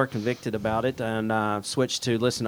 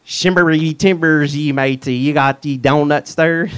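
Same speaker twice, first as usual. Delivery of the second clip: loud, slow hammed-up pirate; 5 semitones higher, 9 dB louder, slower than usual. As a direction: growl it out like a pirate captain. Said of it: "I wanna hear you ask for donuts in a pirate voice".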